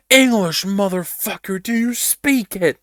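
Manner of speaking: high, loud, slow furious shout; movie-quote angry yell. Acted. Player mocks a foreign singer with a furious movie-quote shout. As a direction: bellow it like a gangster threat. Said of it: "you speak English?".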